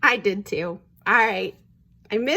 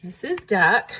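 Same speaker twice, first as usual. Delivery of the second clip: plain reading voice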